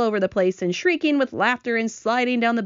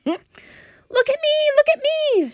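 very high, loud squeal of excitement; excited shouting; 5 dB louder, slower than usual. A narrator's reading. Centipede freed of paint calling to friends, excited shout. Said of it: "shouted the centipede excitedly".

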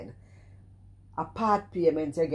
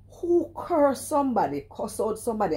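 indignant outburst; 7 semitones higher, 4 dB louder than usual. Real, not acted. Indignant rant.

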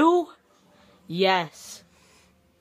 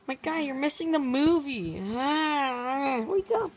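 goofy puppet voice; 5 semitones higher than usual. Pretend. Puppet heckles the couple.

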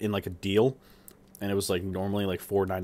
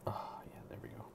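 whispering; 19 dB quieter than usual. Real, not acted. Quiet reaction while filming food.

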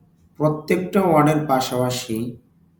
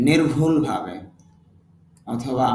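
ordinary talk

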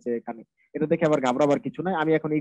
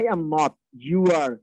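"I" and "you" punched; stress on I and YOU; 4 dB louder, much slower than usual. Shown as a demonstration. Teacher showing informative stress, I and YOU stressed. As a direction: hit "you" louder in contrast to "I". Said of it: "stress দিয়ে বলেছি".

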